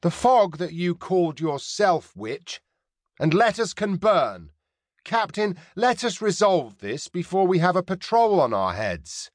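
put-on high, accented pirate captain, scornful. Pirate captain scorns Mary and urges haste, in a scornful accented voice. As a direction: needle them, then push to settle it.

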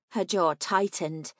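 ordinary delivery

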